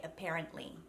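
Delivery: "apparently" as "a-pair-ently"; American E vowel. Shown as a demonstration. Coach quotes Americanised Australians, flat E in "apparently". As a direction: flatten the stressed vowel of "apparently" to E. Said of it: "sounding a little bit American".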